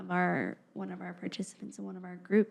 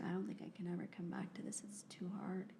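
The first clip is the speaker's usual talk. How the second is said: hushed, fast, near-whispered tearful plea; soft retelling of a crying young man's words; retold crying; 13 dB quieter than usual. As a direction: quietly pass on his tearful words, barely voiced. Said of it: "he's crying and crying".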